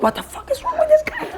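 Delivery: very high, incredulous outcry; shocked neighbours. Retold or imitated. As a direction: squeal it in mock disbelief. Neighbours react, voiced.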